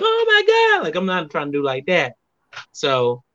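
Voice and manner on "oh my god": high squealed oh my God, half-joking; mock shock. Guest mimics the shock he avoids, high mock gasp. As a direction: act out the shocked gasp you're holding back. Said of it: "I'm trying not to be like".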